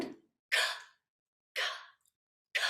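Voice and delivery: short k sounds gasped on the exhale. Shown as a demonstration. Teacher showing the class a gasped k sound. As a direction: flick a k off the diaphragm. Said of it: "a little gasp on a little k sound".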